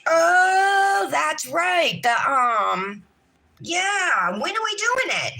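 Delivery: high, loud sudden remembering; excited realization. Real, not acted. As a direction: burst out as it comes back.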